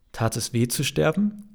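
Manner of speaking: ordinary delivery, nothing marked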